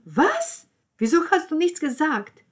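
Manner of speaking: very high, loud disbelief; shocked. Acted. Stunned reaction to the Marcello accusation, shrieked disbelief. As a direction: burst out aghast.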